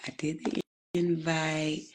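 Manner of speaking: ordinary delivery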